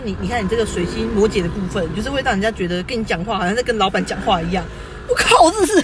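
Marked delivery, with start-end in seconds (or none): 5.1-5.8 high, loud exasperated outburst; real exasperation, voice shooting up; worked up. Real, not acted. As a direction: blow up in disbelief. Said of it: "好，不要激动".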